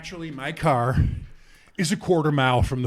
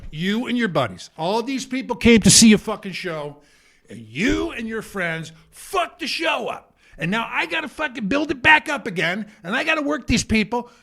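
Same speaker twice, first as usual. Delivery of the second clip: higher-pitched, building retold tirade; angry scolding; 5 semitones higher than usual. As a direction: berate him for what he did. Berating the man before audience.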